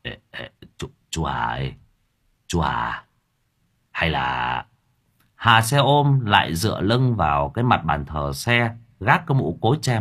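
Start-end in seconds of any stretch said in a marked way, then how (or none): 0.0-4.6 low, soft stammered call; stammering. A narrator's reading. Lâm Thọt stammering as he calls the driver back. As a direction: stumble over the words nervously. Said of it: "Miệng lão lắp bắp".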